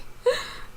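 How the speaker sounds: short high breathy giggle; a quick burst of giggling; airy giggle; real laughter. Real, not acted. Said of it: "the low quality image".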